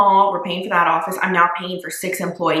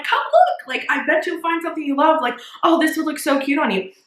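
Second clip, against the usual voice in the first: eager calling out; 8 semitones higher than usual. Retold or imitated. Running to the doors calling shoppers.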